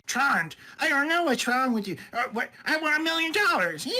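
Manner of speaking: mock the bad audiobook narrator, hammed up and high; mocking a flamboyant audiobook reader in a high voice. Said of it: "this flamboyant dude".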